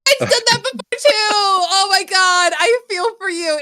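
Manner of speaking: fast and high, excited sympathy. Real, not acted. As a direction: gush with alarmed sympathy. Sympathising with frozen screen.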